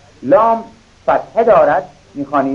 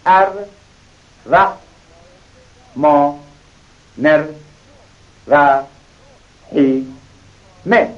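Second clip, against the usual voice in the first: ار، ر، ما، نر، ر، هی، مه each as its own beat with pauses; broken into syllables for a learner; slower than usual. Shown as a demonstration. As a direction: spell out the reading in slow separate syllables.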